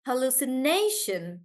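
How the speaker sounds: hallucination with NA stressed; stress on NAY. Shown as a demonstration. Teacher demonstrating hallucination, stress on nay. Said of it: "we stress on nay".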